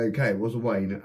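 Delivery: ordinary delivery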